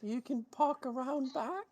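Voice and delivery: high-pitched barmaid voice. Acted. Game master answers as the barmaid.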